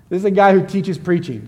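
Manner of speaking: usual speaking voice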